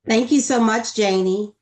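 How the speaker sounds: slightly hoarse, under the weather; a little raspy from a cold. Real, not acted. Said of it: "excuse my voice, I have a little cold".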